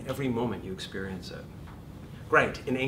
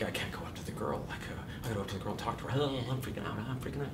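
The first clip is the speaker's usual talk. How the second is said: put-on nervous teen; 7 dB quieter, much faster than usual. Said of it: "you're like".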